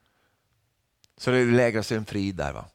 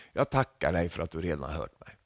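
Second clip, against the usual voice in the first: quiet prayer; 6 semitones lower, 5 dB quieter than usual. Retold or imitated. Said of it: "han ber till Gud så här".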